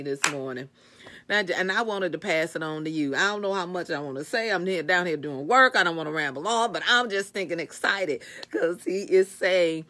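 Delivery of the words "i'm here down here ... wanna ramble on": ordinary talk, nothing marked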